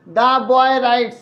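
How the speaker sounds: very slow, word by word; slow dictation. Shown as a demonstration. Teacher dictating the example to students, very slow. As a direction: spell it out slowly for note-takers. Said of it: "আর একটু ধীরে ধীরে বলবো".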